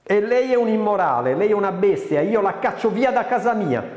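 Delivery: high, loud, angry retort; acted fury, pitch pushed high; indignant outburst. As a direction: hurl insults and throw him out. Acted insult, throwing guest out.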